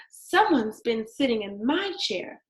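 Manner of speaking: plain reading voice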